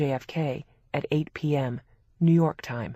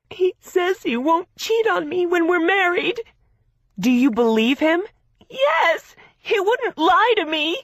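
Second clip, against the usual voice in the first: tearful insisting; 14 semitones higher, 7 dB louder than usual. A narrator's reading. Gracie crying, defending Harry.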